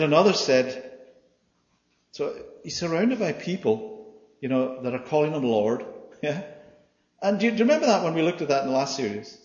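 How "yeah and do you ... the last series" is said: usual speaking voice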